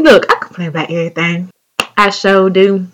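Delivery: ordinary talk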